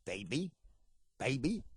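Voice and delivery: put-on low prompting "baby", each rising at the end; Ernest Angley impression. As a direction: prompt a deaf person to repeat "baby". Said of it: "snap his fingers in there and go".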